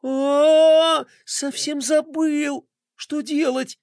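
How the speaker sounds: horrified and ashamed, drawn out; ashamed horror. Acted. Roma stares at the monitor in shame and horror. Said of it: "Со стыдом и ужасом".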